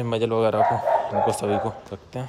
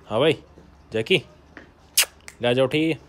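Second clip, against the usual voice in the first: raised, brisk call to a dog; 3 semitones higher, 5 dB louder than usual. Real, not acted. Owner to his guard dog Jackie, calling out. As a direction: call out to get the dog's attention.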